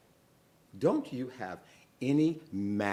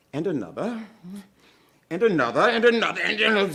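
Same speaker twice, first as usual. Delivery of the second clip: each another higher and louder; building excitement; 10 semitones higher, 12 dB louder, faster than usual. A narrator's reading. Teller to kids, counting the yams, building. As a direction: pile them up, climbing.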